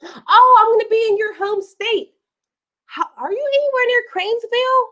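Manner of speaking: high, excited squeal. Real, not acted. Excited at viewer's home state.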